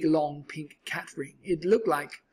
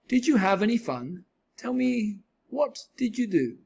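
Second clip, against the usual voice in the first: higher, lilting mother's questions; higher-pitched storybook mother; mother's questioning; 6 semitones higher, 3 dB louder than usual. A narrator's reading. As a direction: ask the children brightly, pitched up.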